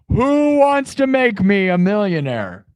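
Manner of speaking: high, slow booming show title; game show announcer. Pretend. Streamer announcing his pretend game show, high and slow. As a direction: announce it like a TV host. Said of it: "the name of the new show I'm starting".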